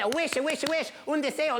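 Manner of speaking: high, repeated delighted exclamations; excited old woman. Acted. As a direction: marvel at the offer in a high auntie voice. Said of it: "She said".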